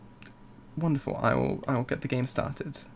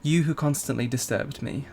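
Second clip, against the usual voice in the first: poem reading; 4 dB quieter than usual. Acted. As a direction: read it like a tender poem. Reads the poem in game.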